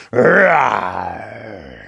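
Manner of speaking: long, loud growling roar; lion roar. Acted. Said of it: "roared the lion".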